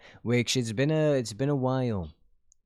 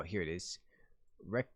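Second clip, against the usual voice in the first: normal talking voice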